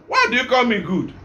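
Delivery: ordinary talk, nothing marked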